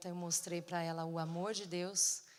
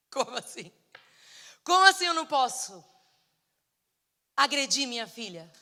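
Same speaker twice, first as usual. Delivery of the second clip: loud, high-pitched indignant outburst; acted-out angry objection, voice raised; irate protest; 4 semitones higher, 13 dB louder than usual. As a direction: demand it in outraged disbelief. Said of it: "quem ficou irada fui eu".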